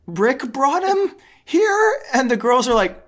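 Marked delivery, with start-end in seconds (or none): 0.1-2.0 high, slow questioning tone; mock uncertain. Imitating mom explaining Santa to the girls, unsure. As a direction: stretch it out, climbing into a question.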